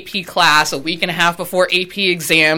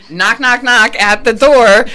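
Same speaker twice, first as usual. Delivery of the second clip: mock knocking; 4 semitones higher, 7 dB louder, slower than usual. Imitating a knock.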